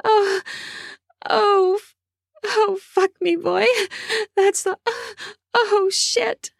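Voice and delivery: high, breathless woman's voice overcome with pleasure; breathless female character. Acted. Woman to her lover in bed, high and breathless. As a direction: gasp it out high as the woman losing control.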